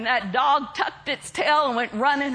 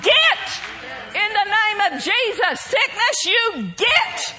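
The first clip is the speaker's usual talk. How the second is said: bold rebuke; 5 semitones higher, slower than usual. Shown as a demonstration. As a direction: rise up and order it out. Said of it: "we gotta rise up and we gotta say".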